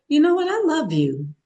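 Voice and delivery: high, loud, delighted you know what; excited stranger. Retold or imitated. Imitating people who suddenly come up, excited. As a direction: burst out with sudden affection. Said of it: "people will just come".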